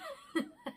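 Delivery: short teary laugh; tearful laugh. Real, not acted. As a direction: laugh through the tears. Laughs at herself mid-tears.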